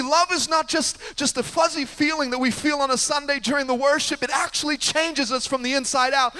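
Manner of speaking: high, loud preaching build. Acted. Preacher pressing the point to the church, preaching high and loud. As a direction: preach it out to the congregation.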